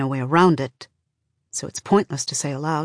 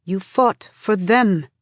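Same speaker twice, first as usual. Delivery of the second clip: slow build to a stressed "them"; weight on "them", said with effort; stress on last word; 3 semitones higher, 3 dB louder, slower than usual. A narrator's reading. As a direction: land deliberately on "them", drawn out. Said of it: "It takes effort to emphasize the last word".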